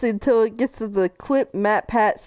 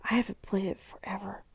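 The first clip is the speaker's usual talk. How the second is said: whispering; whispered aside; 3 semitones lower, 11 dB quieter than usual. Real, not acted. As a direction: say it under your breath.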